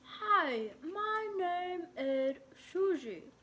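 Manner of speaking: slow, garbled through foamy toothpaste; mouth full of toothpaste. A narrator's reading. Girl greeting her mirror while brushing her teeth, mouth full. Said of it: "with her mouth full of toothpaste".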